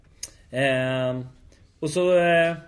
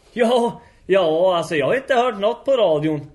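higher, louder acted excuse; mock defensive protest; 5 semitones higher, 7 dB louder than usual. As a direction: protest your innocence loudly. Gubben försvarar sig. (spelat försvarande)

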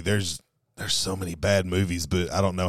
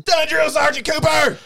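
recruit shouting; 6 dB louder than usual. Acted. Recruit answering drill sergeant.

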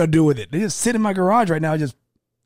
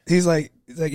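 usual speaking voice

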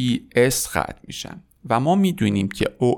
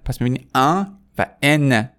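ordinary talk

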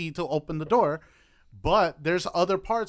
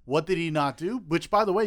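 usual speaking voice

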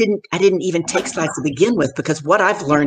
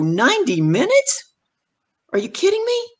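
incredulous. Retold or imitated. New speaker hears the length of her talk, incredulous. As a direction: squeal the question in mock shock. Acted shock at the talk's length.

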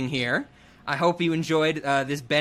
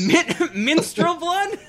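laughing; 12 semitones higher, slower than usual. Real, not acted. Laughing at a funny mix-up.

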